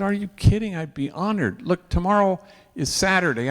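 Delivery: high, bright-eyed yes; eager delight. Retold or imitated. Priest happily agrees to bless the departing daughter. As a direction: jump at the chance to help.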